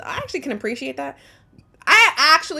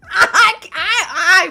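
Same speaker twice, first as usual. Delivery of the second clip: laughing; 12 semitones higher, 9 dB louder than usual. Real, not acted. Laughs while restarting.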